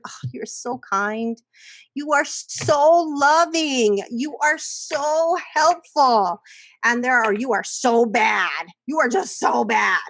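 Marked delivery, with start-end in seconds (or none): 0.1-6.4 higher, slow, gushing praise; praising a child. Retold or imitated. Parent gushing praise at a child, higher and slow. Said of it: "sometimes we say to children". 7.3-10.0 slow scolding, then a shout; scolding a child. Retold or imitated. Parent scolding a child, slow then shouting. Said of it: "we say to children".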